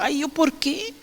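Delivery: whiny, reluctant "why me", lightly played; mock complaint. Preacher mocking her younger self grumbling, whiny. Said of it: "Y yo decía".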